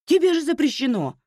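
mother aghast, voice jumping up; gasped shock. A narrator's reading. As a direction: burst out in shocked alarm. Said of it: "ахнула она".

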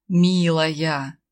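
low seductive purr. A narrator's reading. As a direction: purr the word like a cat. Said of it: "мурлыкнула".

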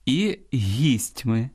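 very slow, stress on the last syllable of "гістьми": гістьМИ; careful stress demo. Shown as a demonstration. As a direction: draw out "гістьми", landing on МИ. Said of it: "в орудному відмінку". (дуже повільно, наголос на останньому складі: гістьмИ)

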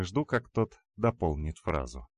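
usual speaking voice

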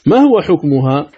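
ordinary delivery

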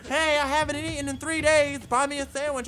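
pleading poor person. Retold or imitated. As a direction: imitate a beggar whining for food. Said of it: "poor person looking all poor and being all like".

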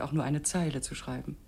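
ordinary talk

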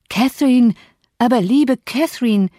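high, loud remonstrance; aghast interjection. A narrator's reading. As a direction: exclaim it, beside yourself with dismay. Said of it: "ganz außer sich über die doppelte Sünde".

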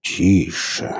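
drawn-out, careful hush; unhurried calming word; said slowly. A narrator's reading. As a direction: hush him slowly and steadily. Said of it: "медленно проговорил Борланд".

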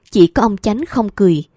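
usual speaking voice

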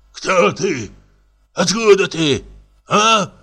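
put-on confused drunk. Пьяный комик в замешательстве.

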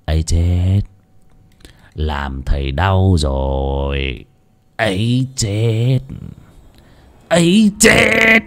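slow, drawn-out false sweetness; mock-sweet. Lão Tuân stabbing Thầy Nghiêm, syrupy mock sympathy. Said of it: "dở giọng ngọt nhạt".

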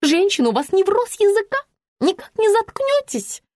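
high, angry nagging; irate high-pitched rebuke; shrewish indignation. A narrator's reading. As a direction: snap at a chatterbox, pitch way up. Woman insults the narrator.

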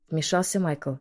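plain reading voice

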